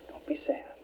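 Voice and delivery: soft unvoiced whisper; whispered comfort. Mother whispering comfort to her son from her hospital bed. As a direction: breathe it to him like a mother at his bedside.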